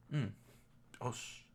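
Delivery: ordinary talk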